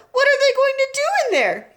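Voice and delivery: very high, loud fear; a mother dreading the answer; frightened mother. A narrator's reading.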